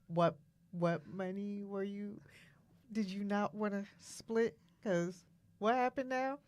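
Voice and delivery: halting, hushed disbelief at what he said; baffled questioning. Retold or imitated. Retells baffled reply to partner.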